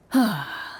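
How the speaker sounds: a long, weary sigh. Acted. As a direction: let the worry out in one heavy breath. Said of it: "lets out a long sigh".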